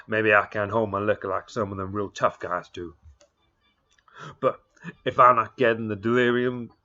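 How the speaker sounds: put-on cowboy ranting at the giant boy; Texas cowboy rant. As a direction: complain bitterly like a drunk Texas cowboy.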